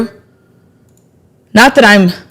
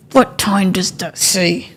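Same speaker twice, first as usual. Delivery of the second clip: hushed; 2 semitones lower, 8 dB quieter, slower than usual. Real, not acted. Repeats, slower and softer.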